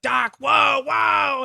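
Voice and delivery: mock corporal's high-pitched pleading yell; imitated alarmed intervention. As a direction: urgently call him off, as the corporal. Corporal intervenes in screaming match.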